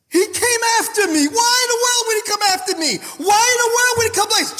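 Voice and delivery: high, fervent, rushing disbelief; high-pitched, racing testimony; impassioned amazement. Real, not acted. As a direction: cry out in astonished wonder. Preacher amazed God came after him.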